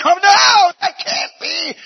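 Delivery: high, loud shocked cry; horrified cry. Acted. Eaglets looking over the edge, crying out in horror. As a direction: gasp it out in horror.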